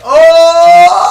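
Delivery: long, very loud high yell; disgusted scream. Acted. Recalling how pungent he was, long disgusted scream. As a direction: break into a sustained high holler.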